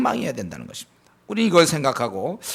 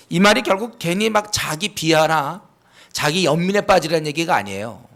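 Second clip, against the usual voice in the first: ordinary talk, nothing marked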